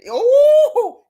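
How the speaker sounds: high, loud laughing ooh; excited ooh. Real, not acted. Host squealing at a viewer comment, high and loud. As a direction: squeal with delight.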